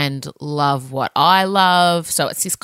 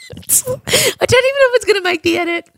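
high, laughing through the words; cracking up mid-sentence. Real, not acted. Laughing confession.

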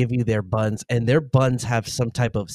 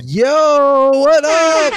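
loud greeting; 13 semitones higher, 8 dB louder, much slower than usual. Real, not acted. Calling out loudly to someone.

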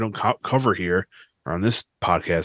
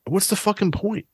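higher, exasperated outburst; 11 semitones higher than usual. Real, not acted. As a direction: throw up your hands in exasperation.

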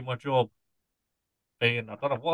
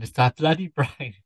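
ordinary talk

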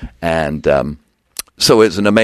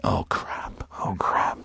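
near-whispered, breathy dismay; soft, sinking retold reaction; dejected hush; 9 semitones lower, 12 dB quieter, slower than usual. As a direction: mutter it under your breath, deflated. Reacting to bad ratings news.

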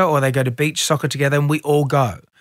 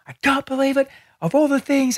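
much higher, softer retold wail; mock despair; 10 semitones higher, 3 dB quieter than usual. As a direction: replay your own meltdown, half-laughing at it. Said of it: "I just was like".

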